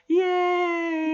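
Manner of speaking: high squealed cheer; squealed yay. Real, not acted. Cheering over his new plant babies, high and squealed. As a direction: squeal with joy.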